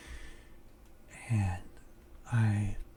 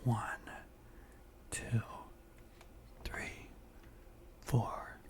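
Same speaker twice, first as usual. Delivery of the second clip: slow, mostly whispered breathing count; guided breathing meditation; 6 dB louder, slower than usual. Acted. Guide a friend through breathing in and out, hushed meditation voice.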